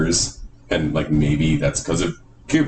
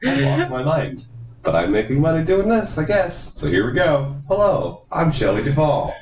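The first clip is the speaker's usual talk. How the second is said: Shelley Duvall on autopilot; 10 semitones higher than usual. Acted. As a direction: sing it out high, running on autopilot. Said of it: "She's kind of on autopilot".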